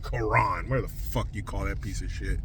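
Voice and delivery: ordinary talk, nothing marked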